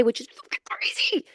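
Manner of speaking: whispered aside. Real, not acted. Podcaster to listeners, whispering in disbelief. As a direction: drop into a whisper for the swear. Aside about the new year.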